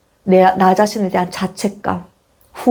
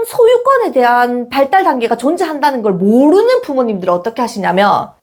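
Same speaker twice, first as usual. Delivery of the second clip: usual speaking voice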